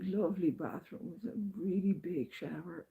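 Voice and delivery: ordinary talk, nothing marked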